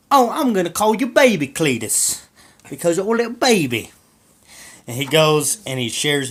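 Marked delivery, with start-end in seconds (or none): 0.1-3.9 high, loud doting voice to a pet; baby talk. Retold or imitated. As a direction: coo over the little turtle. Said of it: "he's like".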